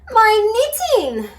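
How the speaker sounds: high, loud cry. A narrator's reading. Mum cries out over her lost knitting. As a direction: cry out in dismay. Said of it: "cried Mum".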